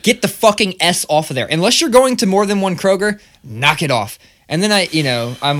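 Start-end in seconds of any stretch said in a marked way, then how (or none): 0.0-4.2 loud, higher, irritated scolding; exasperated rant. Retold or imitated. Mocking shoppers who add an S, annoyed and loud. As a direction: tell them off, fed up. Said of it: "I'm like".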